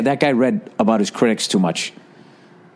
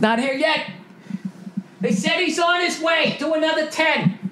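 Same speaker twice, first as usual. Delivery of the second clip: much higher, softer put-on stagehand whisper-call; backstage stagehand; 4 dB quieter than usual. Comic voicing a backstage crew member urging Trump to keep stalling, high and hushed. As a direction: murmur it like a crew guy telling the act to stretch.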